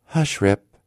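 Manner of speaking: mother crying hush. A narrator's reading. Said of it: "cried she".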